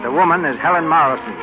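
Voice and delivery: usual speaking voice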